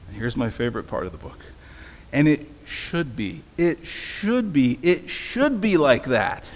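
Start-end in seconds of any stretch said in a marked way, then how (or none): 2.1-6.5 slow, insistent refrain, building each time; emphatic storybook reading. A narrator's reading. Preacher reads favorite part of book, slow and emphatic. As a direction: stretch and press SHOULD on each repeat.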